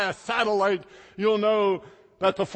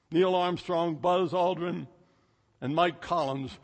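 ordinary delivery

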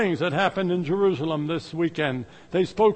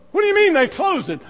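shocked question; 10 semitones higher, 5 dB louder, faster than usual. Retold or imitated. Bishop shocked at church closing.

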